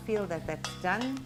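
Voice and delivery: ordinary talk, nothing marked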